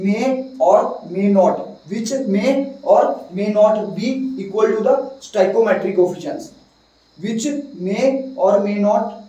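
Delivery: slow dictation pace; slowly, word by word for notes; dictating. Shown as a demonstration. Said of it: "इस line का मतलब समझ आया".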